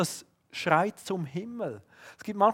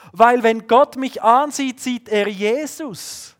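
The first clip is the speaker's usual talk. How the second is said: higher and louder, then slowing on „sieht er Jesus“; rising sermon climax; 7 semitones higher, 10 dB louder, slower than usual. Acted. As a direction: build to the high point of the message. Höhepunkt der Predigt. (predigender Höhepunkt, höher und lauter)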